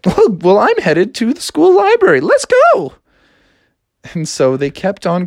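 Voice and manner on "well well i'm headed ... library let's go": high, breathy-free lilting princess. Acted. Princess telling Froggy about her sisters, high voice. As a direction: play the upset princess in a high voice. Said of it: "she's like".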